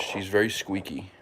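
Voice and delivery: usual speaking voice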